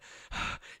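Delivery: imitated winded gasp; out-of-breath panting. Kid worn out from being chased, winded gasp. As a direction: huff out a breath as if spent. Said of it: "just, like, out of breath".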